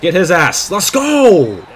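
high, loud cheer; hype shout. Real, not acted. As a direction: yell for the tackle. Cheering the defense.

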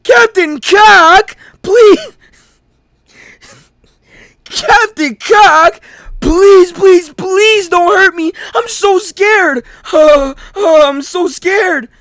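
very high, slow, loud hammed-up pleading; terrified begging. Captive begging Captain Cock not to hurt him, hammed-up terror. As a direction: beg for your life in a squealing cry. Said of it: "I gotta play as him".

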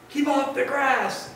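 high-pitched storybook shout. A narrator's reading. Grumpy cactus warning the passing cowboy, shouting. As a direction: shout it as an order. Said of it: "shouted Hank".

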